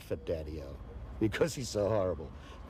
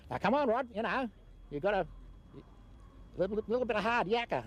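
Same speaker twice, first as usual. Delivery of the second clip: much higher, mock bushman uncle voice; gruff uncle; 9 semitones higher than usual. Uncle rousing young Rod to farm work, mock bushman voice. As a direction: imitate the old outback uncle, higher. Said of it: "with him saying".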